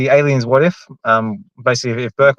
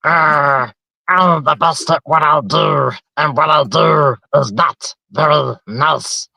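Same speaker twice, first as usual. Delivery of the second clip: Donald Duck Wolverine; 2 semitones higher, much slower than usual. Shown as a demonstration. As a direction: squawk it slowly like a tough duck. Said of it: "I gotta see if I can just, uh, destroy myself".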